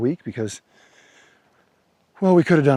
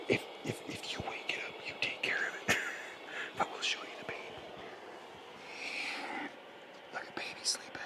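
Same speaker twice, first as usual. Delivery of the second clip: whispering over a crib; 18 dB quieter than usual. Real, not acted. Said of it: "'cause the baby's in here sleeping".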